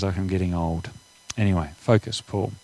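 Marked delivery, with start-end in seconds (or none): none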